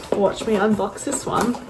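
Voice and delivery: ordinary talk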